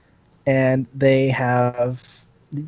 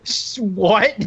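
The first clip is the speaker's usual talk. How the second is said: usual speaking voice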